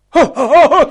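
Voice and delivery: high, loud mocking laugh; he shrieks with laughter; shrieking laugh. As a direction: cackle it out like a taunt. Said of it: "skrek han".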